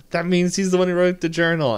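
hammed-up eager kid; mock excited Dipper. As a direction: blurt it out triumphantly. Mimicking Dipper's excited claim.

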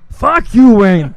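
loud action-star impersonation; high, shouted mock Austrian accent; Arnold Schwarzenegger. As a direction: bellow it like the famous actor. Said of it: "I'm Arnold".

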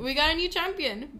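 high, loud thrilled echo; excited announcement. Real, not acted. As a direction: shout the good news back. Echoing excitedly.

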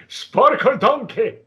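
slow, high, hammed-up cartoon ogre; Shrek voice. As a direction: drag it out like a bad Shrek. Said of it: "I gotta try my worst Shrek".

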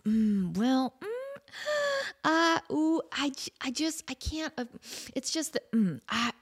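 fumbling, fading reluctant hemming; hesitant dread. Retold or imitated. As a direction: stammer and shrink away from it. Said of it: "And I'm like".

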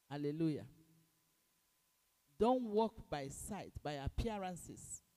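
loud, fervent preaching. Acted. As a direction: preach it with full force. Preaching at full force.

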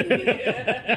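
real high laugh bursting out; high burst of laughter. Real, not acted. Laughing at the memory of the bar fight.

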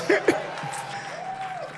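sudden shrieking laugh, very high; high squealing laugh. Real, not acted. Laugh at own joke.

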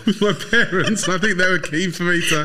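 laughing through the words; giggling as he talks. Real, not acted. Laughing while telling story.

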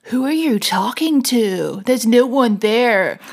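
lightly put-on American accent; a hint of American, slow and drawn. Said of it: "I think that was an American accent".

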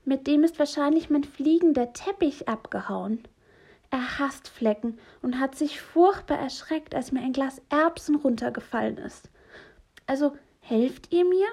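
high, chatty character voice; high-pitched, animated storytelling character; trapped stranger's voice. Acted. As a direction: explain the mishap in a high voice, then plead. Trapped voice explains and asks help.

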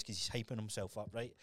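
ordinary talk

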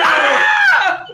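loud high-pitched shriek; real frightened yell; startled scream. Real, not acted. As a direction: scream as if suddenly spooked. Scared by fake roach.

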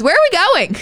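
mock-innocent high "where are we going". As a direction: play her totally lost. Anna clueless after saying the table.

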